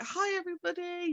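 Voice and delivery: imitating an excited little boy, pitched high; high, sing-out hello without raising volume; excited child greeting. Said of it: "He's all excited".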